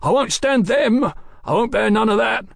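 very high, panicky refusal; cornered crook yelping in protest. A narrator's reading. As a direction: shrink from the handcuffs in a high yelp. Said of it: "Thompson yelped".

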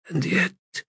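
softly, rising in wonder. Acted. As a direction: let the first one hang in quiet wonder. God repeats, wondering.

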